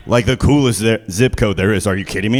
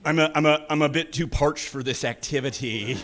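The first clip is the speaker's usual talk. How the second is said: mock parched; 8 dB quieter than usual. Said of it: "Just like".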